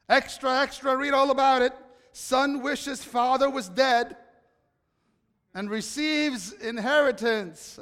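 loud newsboy hawking papers; rapid old-time reporter. Pretend. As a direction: shout the headline to passersby. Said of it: "If there was a newspaper in Jerusalem".

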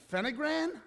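high rising incredulous question; puzzled disbelief. Retold or imitated. Nurse reading the wife's medicine bottle, incredulous. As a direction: question the name in surprise.

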